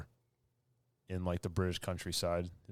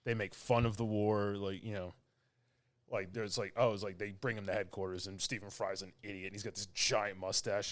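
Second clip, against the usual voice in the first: usual speaking voice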